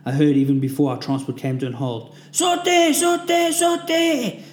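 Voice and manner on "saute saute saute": high, loud repeated yell; shouting. A narrator's reading. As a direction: bellow the order three times. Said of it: "the shouting continued".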